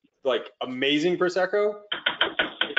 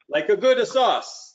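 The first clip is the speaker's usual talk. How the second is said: slow, hammed-up Italian accent, pitched higher; terrible Italian accent; 6 semitones higher, 3 dB louder, slower than usual. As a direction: lean into a cheesy Italian accent, drawing out "good sauce". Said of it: "That's my terrible Italian accent".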